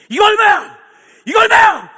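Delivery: high, booming demand; very loud, high acted holler; retold shouting. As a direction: bellow it as the brash old customer. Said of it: "막 소리 지르니까".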